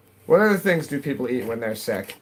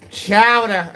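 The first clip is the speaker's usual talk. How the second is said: high, loud New England "Chowder", ending in -ah; New England accent; 8 semitones higher, 6 dB louder than usual. Shown as a demonstration. Streamer emphasizing the accent for viewers, exaggerated New England accent. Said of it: "I'm emphasizing the, uh, the New England accent".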